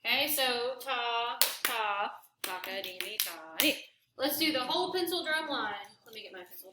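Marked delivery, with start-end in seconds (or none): 0.8-3.8 slow, careful ta-ta-ta-ka-di; slow rhythm reading. Shown as a demonstration. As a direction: spell out the rhythm slowly. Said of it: "repeats student's rhythm".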